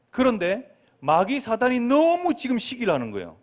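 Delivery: ordinary delivery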